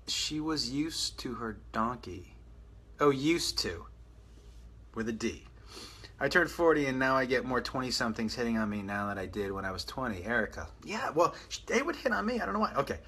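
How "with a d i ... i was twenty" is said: normal talking voice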